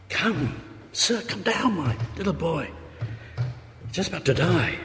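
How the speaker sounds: pleading father. Retold or imitated.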